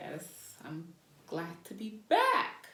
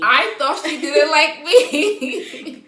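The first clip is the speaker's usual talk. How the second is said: real laughter at the shared mix-up; laughing; 7 semitones higher, 12 dB louder, much slower than usual. Real, not acted. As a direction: laugh through it, slow, high and loud. Mutual misunderstanding revealed.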